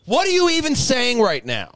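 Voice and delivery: high, loud mock scolding of himself; mock self-scolding. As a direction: berate yourself in disbelief. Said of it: "I'm just like".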